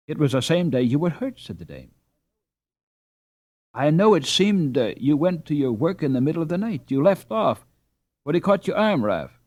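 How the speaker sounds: a hint of the dame's voice. A narrator's reading. The dame to her husband, lightly voiced housewife. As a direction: speak up as a brisk, caring wife.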